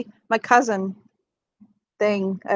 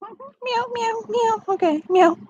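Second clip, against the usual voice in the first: cat meowing; 13 semitones higher, slower than usual. Pretend. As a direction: meow like a small worried cat.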